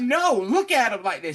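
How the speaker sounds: high, loud retold protest; upset. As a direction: imitate her protesting, upset. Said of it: "She's upset".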